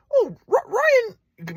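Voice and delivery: high mocking grandpa voice; grandpa's voice. Son mocking his grandpa, high-pitched. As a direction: jump high to mock grandpa. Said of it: "my grandpa was like".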